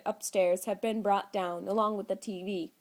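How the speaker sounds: ordinary delivery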